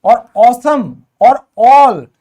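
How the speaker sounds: slow, stretched ऑसम and ऑल; teacher drawing out the vowel for students; drawn-out ऑ sound. Shown as a demonstration. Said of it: "इसमें हमारी आवाज आती है ओ".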